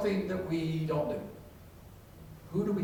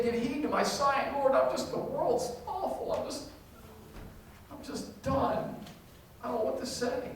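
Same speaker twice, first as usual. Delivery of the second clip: weary despairing prayer; 8 semitones higher, 4 dB quieter than usual. Acted. Psalmist's weary prayer voiced.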